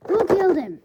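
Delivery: high, loud alarmed yell; shocked shout. Acted. Twice shouts in shock about the death, loud. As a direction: demand it in alarm.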